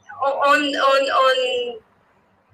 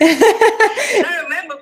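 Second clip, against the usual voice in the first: excited, laughing; 4 semitones higher, slower than usual. Real, not acted. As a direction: tell it with a laugh. Laughs while recalling.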